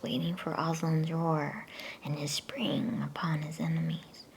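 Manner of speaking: ordinary delivery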